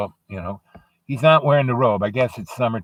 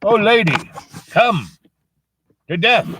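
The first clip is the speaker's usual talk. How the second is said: raised, louder calls with pauses; Death summoning; 5 semitones higher, 4 dB louder than usual. Acted. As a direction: summon the old lady as Death, word by word. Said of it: "and he goes".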